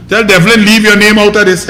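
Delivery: ordinary delivery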